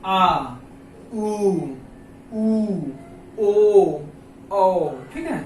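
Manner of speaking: आ, उ, ओ, औ loud from the back of the mouth; back vowel demo. Shown as a demonstration. Teacher demonstrating back vowels for students, loud. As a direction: belt out the back vowels, ओ loudest. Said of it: "बिल्कुल एकदम पीछे से आवाज आए".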